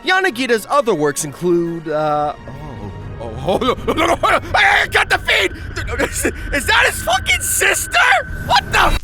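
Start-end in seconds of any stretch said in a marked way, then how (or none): none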